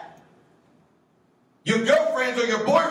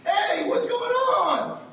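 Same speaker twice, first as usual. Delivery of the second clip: high, slow, laughing hey; a pastor's overeager hello, stretched out; goofy greeting; much slower than usual. Retold or imitated. Pastor greeting teens at school, high and drawn out. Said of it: "I said".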